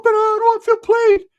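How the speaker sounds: high squeaky falsetto; hammed-up squeaky sidekick; Ricky's voice. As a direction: pitch way up into a goofy falsetto. Ricky voiced in retold story.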